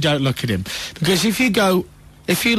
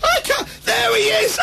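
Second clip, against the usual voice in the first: much higher, a thrilled shout; giddy mum shriek. Retold or imitated. Mum shouting to the family that she's spotted him, high and thrilled. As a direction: squeal like a mum who's just spotted him.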